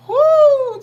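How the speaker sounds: loud, high-pitched whoo; fanning-herself whoo. Real, not acted. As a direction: whoop it out. Exclaims about a fine man.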